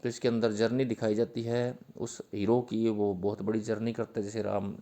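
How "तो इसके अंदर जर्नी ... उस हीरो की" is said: normal talking voice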